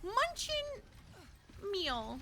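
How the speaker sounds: high, slow puppet voice; Elmo. Retold or imitated. Streamer coining a meal name as Elmo, high voice. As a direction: draw the words out in a high puppet voice.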